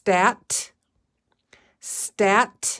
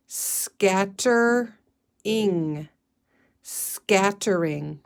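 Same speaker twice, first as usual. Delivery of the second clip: stretchy snaking; much slower than usual. Shown as a demonstration. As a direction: stretch Scattering slowly for the class. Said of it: "stretchy snaking".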